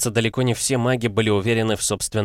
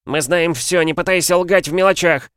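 high, loud falsetto; heated falsetto; 10 semitones higher, 5 dB louder than usual. Acted. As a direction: falsetto shout. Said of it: "распалялся всё тот же маг".

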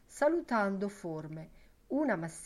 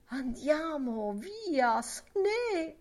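drawn-out coaxing, climbing very high; coaxing urging; 9 semitones higher, slower than usual. A narrator's reading. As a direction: egg her on, stretching each word upward. Lenehan keeps urging the barmaid on. (incitamento insistente in crescendo acuto)

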